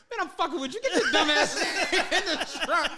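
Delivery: high-pitched, breaking into laughter; laughing reveal. Retold or imitated. Friend revealing the prank by the truck, laughing and high-pitched. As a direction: crack up while imitating the prankster.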